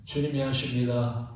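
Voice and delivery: "주님이" set apart low and soft, falling at the end; solemn low declaration. Acted. Preacher to the congregation, turning humble and low on "주님이". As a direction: drop the voice low and lay it down on "주님이".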